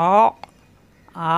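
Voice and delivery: অ, আ pressed in the middle of the throat as ain; Arabic ain demo; throat sound. Shown as a demonstration. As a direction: squeeze অ from the mid-throat. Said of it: "এরকম কণ্ঠনালীতে চাপ দিয়ে".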